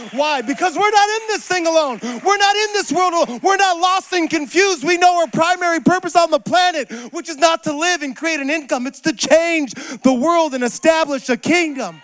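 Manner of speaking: full-force preaching. Real, not acted. Preacher rallying believers to their purpose, fervent. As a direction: drive each phrase hard to a shouted climax.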